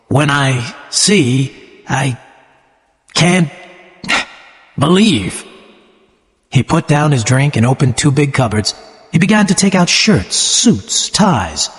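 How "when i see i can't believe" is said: slow, halting words with long pauses; said slowly. A narrator's reading. Gatsby to Daisy and the narrator, overwhelmed: slow halting speech. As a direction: let each word come out haltingly, half dazed. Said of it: "Gatsby said slowly".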